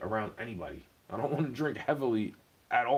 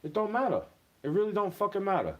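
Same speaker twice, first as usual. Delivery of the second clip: higher, exasperated insistence; frustrated outburst; 7 semitones higher than usual. Real, not acted. Ranting about noise keeping him awake, exasperated. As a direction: throw up your hands, fed up. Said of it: "when you're trying to sleep".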